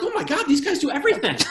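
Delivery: amazed, high and rushed reaction; stupito. Retold or imitated. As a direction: gush it fast and high, wildly impressed. Acts out his own amazed reaction.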